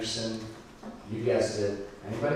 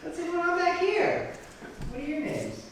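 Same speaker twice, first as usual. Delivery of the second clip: crowd work. Real, not acted. Calling out to back row.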